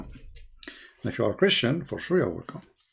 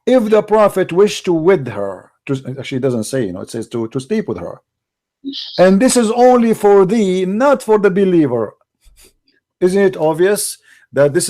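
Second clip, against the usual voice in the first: louder, fierce preaching rant; angry loud ranting; 3 semitones higher, 8 dB louder than usual. Real, not acted. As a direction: hammer every point loudly. Heated attack on a verse.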